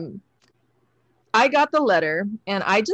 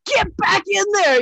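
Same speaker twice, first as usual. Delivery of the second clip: bossy mentor; 12 semitones higher, 4 dB louder than usual. Retold or imitated. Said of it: "he was just like".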